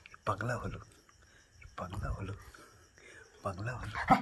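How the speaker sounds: পাগলা হলো breathed in a whisper with a lift at the end. Real, not acted. Owner teasing the waiting dog, a whispered পাগলা হলো.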